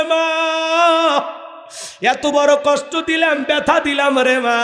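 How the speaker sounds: high, crying lament at the grave; a son wails for his dead mother; loud wailing. Acted. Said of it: "হাউমাউ করে কাঁদে আর বলে". (উঁচু গলায় হাউমাউ কান্না)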